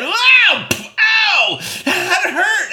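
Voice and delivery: high, loud yelps of pain; cry of pain. Retold or imitated. Boy hit by babysitter's shoe, high loud yelps. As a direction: yelp as the shoe hits.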